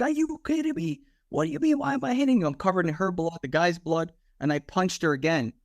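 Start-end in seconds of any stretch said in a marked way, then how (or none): 0.0-2.5 high, disbelieving outburst; incredulous. Retold or imitated. Cop retorting to the woman who pulled a gun, high disbelief.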